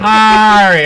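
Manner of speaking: loud, high "Harry" with a broad "ah"; exaggerated attempt. Shown as a demonstration. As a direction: belt out "Harry" as "Hah-ree". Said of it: "How's that?".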